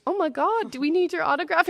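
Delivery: high, amazed imitation of the vendor; excited vendor. Retelling the vendor who asks for her autograph, high and amazed. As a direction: play his starstruck delight. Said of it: "And he goes".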